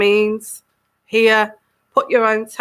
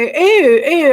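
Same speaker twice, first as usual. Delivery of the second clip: high, loud try at a royal voice; testing out a regal voice on one syllable; the Queen; 5 semitones higher, 6 dB louder than usual. Acted. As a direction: pitch it up high, hammed-up royalty. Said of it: "I'll try again".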